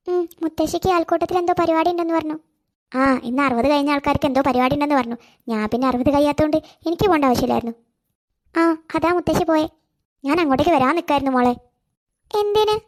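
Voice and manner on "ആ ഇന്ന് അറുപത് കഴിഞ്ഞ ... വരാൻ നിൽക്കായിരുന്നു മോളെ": put-on lower, older auntie voice talking gently to a little girl; a fond elderly neighbour, coaxing; kindly old neighbour woman. Neighbour explains grandmother's absence. (സ്നേഹമുള്ള പ്രായമായ അയൽക്കാരി, താഴ്ന്ന ശബ്ദത്തിൽ)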